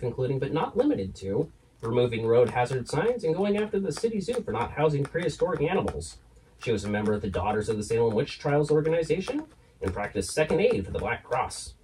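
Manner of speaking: ordinary delivery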